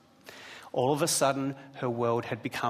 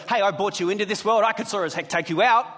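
louder, higher, hammed-up parental threat; mock threat; 7 semitones higher, 7 dB louder, faster than usual. Dad jokingly threatening his daughter, hammed-up anger. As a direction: bark it like an exasperated dad, tongue in cheek. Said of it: "Absolutely not".

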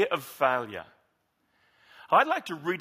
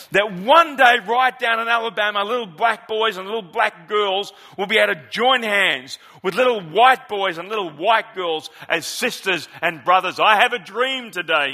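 put-on higher, louder Southern preacher accent; Martin Luther King accent; 4 semitones higher, 8 dB louder than usual. A pastor quoting the speech in a Southern preacher accent, louder. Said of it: "I just want to do his accent".

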